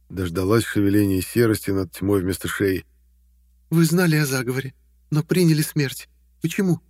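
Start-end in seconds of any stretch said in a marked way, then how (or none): 3.7-6.8 high questioning female voice; young woman voice. Acted. Tasha questions the ghost.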